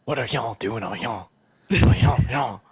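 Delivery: mock bayou accent building to loud; Cajun accent. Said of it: "I'm trying to do like a bayou accent".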